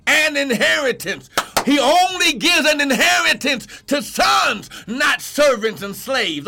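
rising, emphatic sermon cry; full-force preaching. Real, not acted. Preacher telling sons of their inheritance, full-force preaching. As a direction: build the verse into a proclamation.

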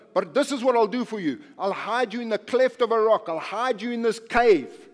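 loud, driving sermon voice; rising fervent preaching, punching each phrase; preaching at full force. Acted. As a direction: preach it hard to the congregation.

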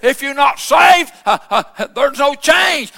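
ordinary talk

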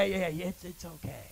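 dropping low and soft, coaxing; soothing persuasion. Acted. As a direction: wave it away softly, trailing down. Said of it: "paint 'em in to convince you that".

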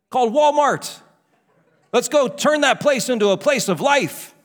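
high, driving preacher delivery; full-force preaching. Real, not acted.

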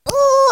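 high, loud imitated schnauzer howl; loud high little dog howl; dog bugle howl. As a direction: throw your head back and bay like the dog. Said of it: "he went".

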